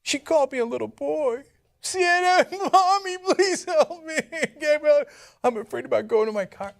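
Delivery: put-on high, whiny frightened little boy, laughing through it; mock a scared kid calling for mommy; mocking Ben Shapiro. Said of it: "I love how Shapiro's like".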